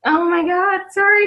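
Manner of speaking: the voice cracks into a sob; real crying, sorry through tears; breaking into tears. Real, not acted. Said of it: "I'm gonna cry".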